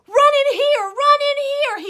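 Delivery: high loud calling scream. Acted. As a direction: scream to lure them inside. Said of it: "he screamed".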